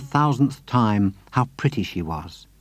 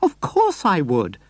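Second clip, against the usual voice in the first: eager cry; 7 semitones higher, slower than usual. A narrator's reading. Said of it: "Missus Silver cried".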